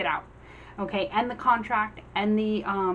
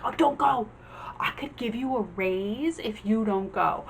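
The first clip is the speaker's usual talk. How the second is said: pleading employer. Retold or imitated. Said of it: "a company trying to win you back".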